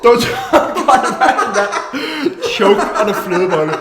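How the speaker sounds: giggling through the words; laughing. Real, not acted. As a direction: laugh while speaking. Laughing through watching someone choke.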